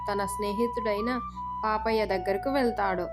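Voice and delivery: plain reading voice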